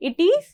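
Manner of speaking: IS swept sharply upward, left hanging; fill-in prompt. Shown as a demonstration. Teacher cues students to fill the blank, rising prompt. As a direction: raise the pitch on IS as an open cue.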